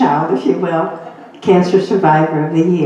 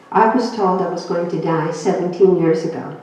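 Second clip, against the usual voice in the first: ordinary talk, nothing marked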